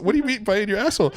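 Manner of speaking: high, quick baffled mock question; baffled cop. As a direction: ask it laughing and confused. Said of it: "talking in a French accent".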